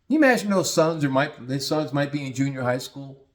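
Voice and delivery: usual speaking voice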